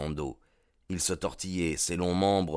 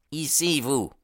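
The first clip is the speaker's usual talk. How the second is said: a lightly raised, curt call to the men; Baldwin's commanding voice; 8 semitones higher than usual. Acted. Gang leader summoning his men, curt command. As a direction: point and summon them, a touch higher.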